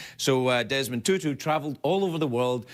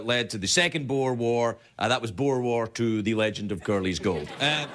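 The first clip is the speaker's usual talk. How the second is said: ordinary delivery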